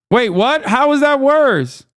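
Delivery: high-pitched, incredulous protest. Real, not acted. Streamer told the retry is worse, incredulous. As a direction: voice leaps up in disbelief.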